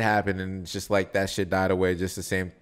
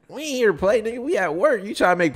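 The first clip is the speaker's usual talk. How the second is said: mock angry scolding. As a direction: scold the prankster coworker. Imagined coworker scolding prankster.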